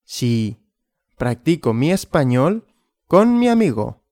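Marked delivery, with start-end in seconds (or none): none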